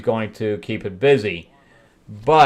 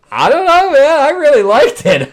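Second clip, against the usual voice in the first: loud, high-pitched rave; real enthusiasm; 9 dB louder than usual. Real, not acted. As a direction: burst out with how much you loved it. Gives enthusiastic verdict on issue.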